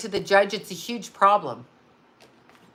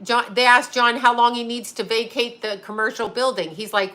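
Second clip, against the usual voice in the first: ordinary delivery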